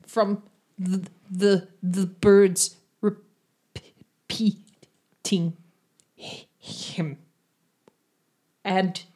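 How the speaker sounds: halting stutter with long silent blocks; large stutter. Acted. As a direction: stammer hard, stuck on first sounds. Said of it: "a large stutter".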